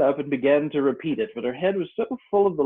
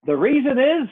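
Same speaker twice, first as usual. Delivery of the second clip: higher, louder Griffin; 13 semitones higher, 7 dB louder than usual. Acted. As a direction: announce it grandly as the Griffin. Griffin explains to Alice.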